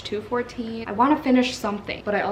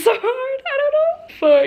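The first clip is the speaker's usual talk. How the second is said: swooning squeal; 7 dB louder, slower than usual. Real, not acted. Swooning over a romantic line.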